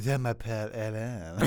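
tongue-out French accent; exaggerated French accent. Shown as a demonstration. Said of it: "hold your tongue out when you speak French".

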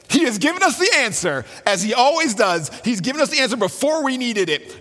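thrilled discovery, voice leaping up; excited. Retold or imitated. Betsy tells her sister God answered, excitedly. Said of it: "she yells with excitement".

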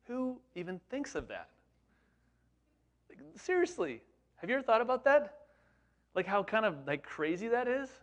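amazed disbelief. Real, not acted. Preacher asking the congregation, in high amazed disbelief. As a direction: pitch leaps way up in wonder. Preacher marvels at Peter's boldness.

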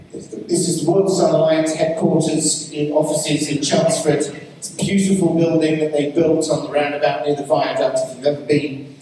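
usual speaking voice

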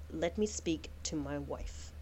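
low, slow, hushed request; quiet and heavy, deep in thought; Salemla speaking low. Acted. Salemla asking to speak to her wife, low and slow. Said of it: "and she goes".

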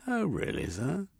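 high, mocking disbelief; sceptical sergeant. A narrator's reading. Sergeant Koff doubts Jeremy's story, sarcastic. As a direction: drip with sarcasm.

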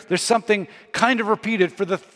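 usual speaking voice

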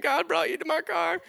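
high, strained voice of a crying woman. Retold or imitated. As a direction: let her voice crack way up with emotion.

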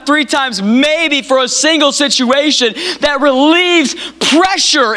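high, driving sermon build that eases at the end; impassioned preaching. Real, not acted. Preacher chiding a church for praying only for relief, impassioned. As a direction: climb to a high, urgent preaching pitch.

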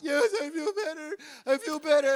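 high, loud mock tearful yes through sniffles; sobbing kid. The boy answering the lady, mock teary and high. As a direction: whimper it like a consoled child. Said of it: "And I'm like".

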